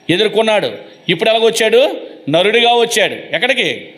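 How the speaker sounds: ordinary talk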